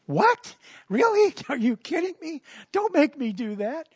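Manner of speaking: mock outrage at being sent back; incredulous protest. Paul balks at leaving heaven, high incredulous whine. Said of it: "Paul's like".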